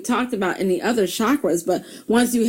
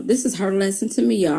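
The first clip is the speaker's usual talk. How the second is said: usual speaking voice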